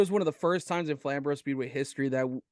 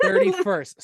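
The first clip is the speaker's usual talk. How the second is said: high, bright squeal of surprise at being corrected; surprised laughing echo; 7 dB louder than usual. Real, not acted. Host caught off by a day, squealing the date back in surprise.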